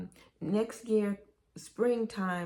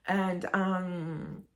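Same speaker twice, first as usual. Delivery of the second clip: usual speaking voice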